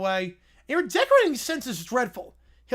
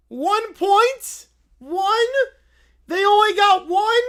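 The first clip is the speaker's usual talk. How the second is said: high, loud outraged little bear; Little Brown Bear voice; 8 semitones higher, 9 dB louder, slower than usual. Acted. Little Brown Bear shocked at the scoreboard, high and loud. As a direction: squeak out your shock like a toddler bear. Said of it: "I don't know why Little Brown Bear talks like this".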